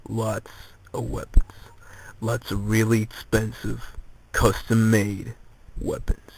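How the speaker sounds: breathy conspiratorial hush, trailing off; hushed whisper. Retold or imitated. Edgar whispering his weapon demands to the girl. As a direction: drop to a near whisper on the weapons.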